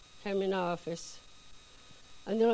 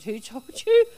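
louder, higher, startled question; shocked loud demand; 4 semitones higher, 12 dB louder, slower than usual. Retold or imitated. Boss caught stealing, demanding who told her, shocked and loud. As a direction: blurt it out as if caught red-handed.

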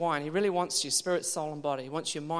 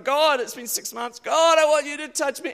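high, loud plea climbing higher; desperate pleading; 8 semitones higher, 7 dB louder than usual. Retold or imitated. Preacher re-enacts praying as the last meeting closes, desperate. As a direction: implore God, building in pitch and volume.

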